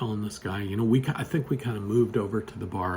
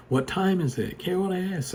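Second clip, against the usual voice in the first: mock yell; 7 semitones higher than usual. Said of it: "my mom's yelling out".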